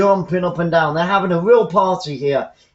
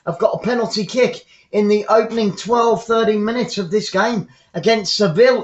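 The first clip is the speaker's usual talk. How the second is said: ordinary talk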